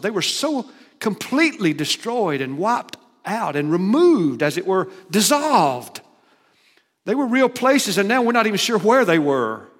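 preaching at full force. Acted. Preacher to the congregation about destroyed cities, booming. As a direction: drive home the ruin from the pulpit.

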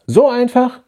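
So einfach? rising steeply in disbelief; incredulous. A narrator's reading. Incredulous question, contrasted. (ungläubig, So einfach? stark steigend)

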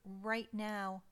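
ordinary delivery

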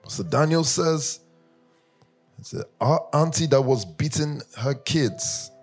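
ordinary talk

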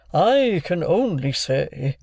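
slow, high, drawn-out character voice; Wopsle. A narrator's reading. Wopsle begins answering before being stopped, slow and pompous. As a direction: hedge pompously, stretching the words.

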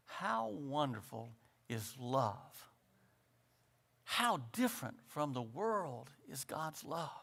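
soft, low, reflective preaching; hushed reverence. Acted. Preacher marvelling at God's love after the reading, hushed reverence. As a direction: marvel quietly, pausing between phrases.

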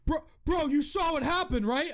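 put-on a scared young driver begging for support; panicky kid. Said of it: "he's like".

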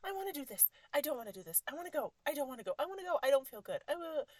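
high, loud back-and-forth whining; mock someone flip-flopping in a tantrum; indecisive people mimicked. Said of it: "these people who are like".

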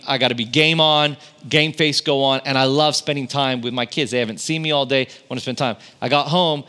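ordinary talk, nothing marked

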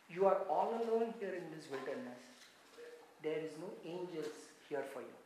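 low, very soft tempter's words; quiet insinuation. Retold or imitated. Preacher voicing Satan to a lonely Jesus, hushed. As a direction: draw it out softly, low in pitch.

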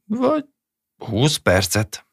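high, slow offhand retort; tossed-off reply. Acted. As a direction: fling the answer at him carelessly. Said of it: "vetette oda az asszony".

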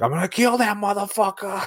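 high-pitched mock scream, Boston accent; screaming in Bostonian. Host mimics Harry's rage in the film, screaming Boston accent. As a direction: yell a threat in a hammed-up Boston accent. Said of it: "Screaming in Bostonian".

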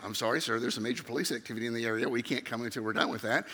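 low, soft, hurried official voice; police dispatcher. Retold or imitated. Dispatcher refuses the repeat caller, low rote official voice. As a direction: recite it low and fast, like a routine refusal. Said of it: "I call the police again".

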